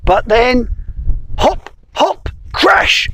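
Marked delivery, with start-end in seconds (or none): 1.4-3.1 squeaky hop, hop, crash; high hop noises. Acted. Storyteller voicing the frog's hops, high. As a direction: bounce the sounds up high. Frog hopping sounds.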